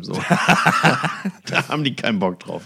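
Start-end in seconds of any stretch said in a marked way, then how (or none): 0.2-1.4 bursts out laughing; real amused laugh. Real, not acted. Laughing at the band's blunt reply, loud laugh.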